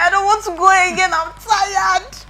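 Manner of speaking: high, loud, fast whine; mock tired whine. Lagging runner complaining at the back, high fast whine. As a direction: whine like an exhausted kid. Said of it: "I was like".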